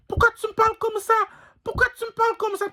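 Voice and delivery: squeaky high fast woman's voice; high falsetto woman. Acted. Pimp voicing the woman he insults, fast high falsetto. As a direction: play the offended girl in shrill falsetto.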